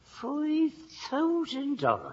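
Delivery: awed. Acted. Sergeant awed by reward sum.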